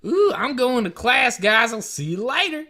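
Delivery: high, loud hammed-up twang; country twang. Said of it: "I would never be like".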